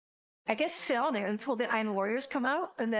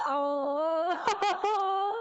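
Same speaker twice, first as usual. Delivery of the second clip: pained laugh-cry; 8 semitones higher than usual. Real, not acted. Realising the boxes clash.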